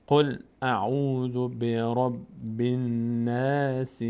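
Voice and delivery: very slow, sustained verse with vowelled ending; slow recitation. Shown as a demonstration. Quran teacher modelling the whole verse, slow measured recitation. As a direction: hold each long vowel, ending "الناس" on a short i.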